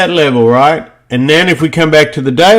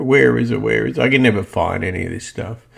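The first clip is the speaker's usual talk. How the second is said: lower, softer aside while searching; muttering to self; 4 semitones lower, 8 dB quieter, faster than usual. Real, not acted. Trader hunting for his crosshair on screen, muttering to himself. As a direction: grumble it quietly to yourself as you hunt.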